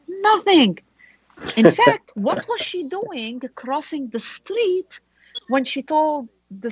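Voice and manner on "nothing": "nothing" lifted high and punched; quoting her word. Retold or imitated. Lawyer mocks client's claim, "nothing" stressed high. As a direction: hit "nothing" as her own word, much higher. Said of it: "to use her words".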